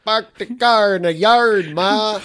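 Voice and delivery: loud, high, bad Boston accent. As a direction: call to Ma in mock Bostonian. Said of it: "that's not a good Boston accent".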